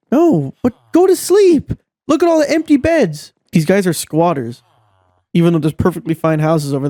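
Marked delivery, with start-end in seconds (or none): 0.1-3.3 exasperated yell. Real, not acted. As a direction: yell at them to just go to sleep. Yelling at villagers who won't sleep.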